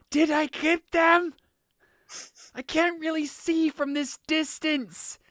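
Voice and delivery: high, slow shout of someone deafened; really loud. Acted. Deafened woman asking her companion about the hit, really loud. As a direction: shout as if you can't hear yourself. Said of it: "really loudly says".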